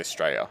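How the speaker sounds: STRAYA with the first syllable of Australia cut off; slurred Australian pronunciation. Shown as a demonstration. Streamer showing how some people say Australia, slurred Australian pronunciation. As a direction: slur "Straya" into one run-together word. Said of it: "we slur stuff together".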